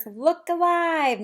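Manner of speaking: ordinary delivery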